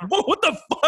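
a sudden high, startled whoa; stunned whoa. Retold or imitated. Drunk warrior stunned by his steward's deduction, startled. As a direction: jump up in pitch, caught off guard.

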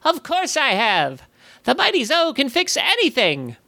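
tiny, high-pitched boastful goblin. A narrator's reading. Goblin calling from inside the watch, tiny voice. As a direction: squeak it out proudly, very high. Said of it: "A tiny voice echoed from the brass casing".

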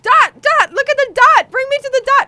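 high, loud pleading at the driver; mock desperate plea. Imagining begging the cab driver, high mock plea. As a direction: squeal it like a lost tourist.

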